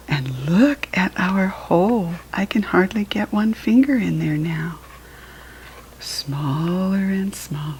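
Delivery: whispered, slowing to the end; hushed wonder. Acted. Showing the child the shrinking hole, soft whisper.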